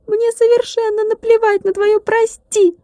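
high tiny voice, each word rapped out; the glass drummer snapping back without mercy; ruthless clipped. A narrator's reading. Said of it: "беспощадно отчеканил стеклянный барабанщик". (беспощадно чеканит, высоким тонким голоском)

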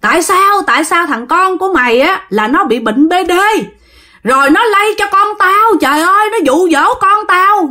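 high-pitched angry calling-out; acted doorstep tirade, pitched well up; father cursing outside. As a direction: demand he come out, like an enraged father. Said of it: "Ba đứng ở ngoài ba chửi".

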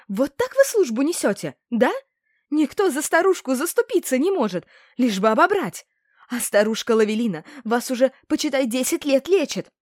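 put-on high-pitched, wailing old woman; theatrical outrage. A narrator's reading. Old seller complaining to guards, theatrically aggrieved. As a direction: lay on the wronged-granny act. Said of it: "Театр одного актёра только набирал обороты".

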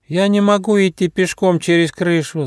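high, whiny little platypus; whining. A narrator's reading. Platypus balking at crossing the mountains, whining. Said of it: "захныкил утконос".

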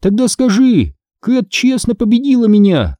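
high-pitched bargaining; teasing demand. A narrator's reading. Sister dictating terms to locked-out brother, teasing.

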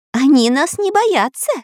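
high alarmed squawk; high-pitched bird panic; frantic parrot. A narrator's reading. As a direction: cry out like a crazed parrot. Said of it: "как сумасшедший попугай Кутя-Плютя".

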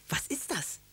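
high, eager question, kept light; eager little boy's curiosity; excited child. A narrator's reading. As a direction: ask with hushed, high-pitched excitement. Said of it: "Karlchen war ganz aufgeregt".

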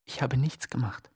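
hushed protest of innocence; whispered refrain. Acted. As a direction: whisper it like a mantra.

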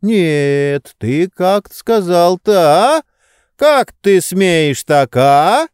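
loud, high, drawn-out outrage; indignant. A narrator's reading. Master confronts servant over rude words, indignant. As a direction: challenge him, pitch climbing on each question.

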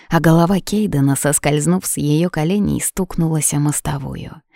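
usual speaking voice

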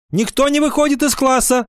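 high-pitched schoolgirl giving orders; commanding. A narrator's reading. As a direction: issue a command to the whole class. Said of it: "скомандовала Ира Сыркина". (командным тоном, высоким голосом)

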